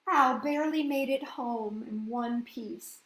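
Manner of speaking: ordinary delivery, nothing marked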